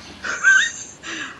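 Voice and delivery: squealing burst of laughter; high shrieking laugh. Real, not acted. Gamer squealing with laughter at her friend's joke.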